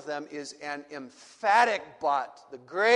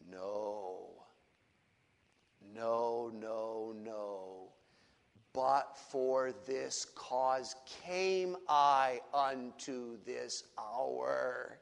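soft, slow, much lower settled answer; quiet resolve; 7 semitones lower, 8 dB quieter, much slower than usual. Acted. Preacher voicing Jesus, hushed and resolved after pleading. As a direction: let the plea die into hushed acceptance.

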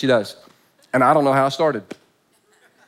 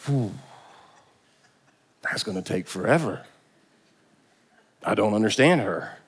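low, soft, defeated husband; weary husband; 7 semitones lower, 6 dB quieter than usual. Retold or imitated. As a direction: sigh it out like a tired husband. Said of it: "there's men in here going".

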